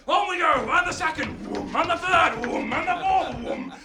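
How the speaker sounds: high, loud count with whooshing booms; excited escalation. A narrator's reading. Storyteller as ship after ship ignites, excited and loud. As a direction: fire off each explosion with relish.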